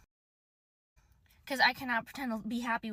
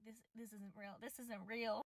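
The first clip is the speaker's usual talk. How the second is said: stunned disbelief; 2 semitones lower, 16 dB quieter, slower than usual. Retold or imitated. Disbelief as he keeps yelling.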